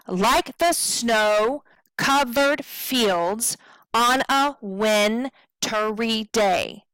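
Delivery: slow short-short-long beats, long on SNOW, FIELDS, WIN, DAY; anapestic rhythm. Shown as a demonstration. Teacher demonstrating poem's rhythm, stressing SNOW, FIELDS, WIN, DAY. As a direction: beat out the meter, pausing after "snow" and "fields". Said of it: "That is our rhythm".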